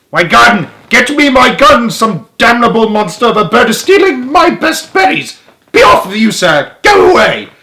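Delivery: high, very loud outraged tirade; furious shouting. A narrator's reading. Duke yelling at bird stealing cherries, furious shout. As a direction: bellow in outrage at a thief. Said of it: "the Duke was shouting".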